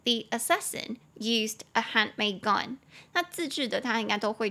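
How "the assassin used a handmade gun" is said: slow, careful English model sentence; slow model reading. Shown as a demonstration. Teacher reading the model sentence aloud to students, slow and clear. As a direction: read it slowly and clearly for learners. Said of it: "所以他就说这个刺客用的是自制的手枪".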